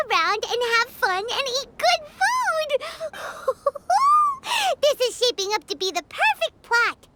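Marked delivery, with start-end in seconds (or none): 3.2-4.8 high, shrill burst of laughter; triumphant giggle; gleeful laugh. Acted. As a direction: cackle with glee at the plan. Paimon laughs at perfect plot.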